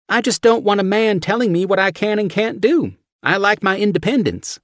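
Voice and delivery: high, loud brassy Southern woman; thick Southern accent. A narrator's reading. Steph shoving chili at John, thick Southern accent. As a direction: push the chili on him, Southern.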